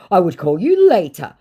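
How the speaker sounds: high, loud scammer voice; emphatic mock-scammer reply. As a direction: answer as the put-on scammer. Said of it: "he said".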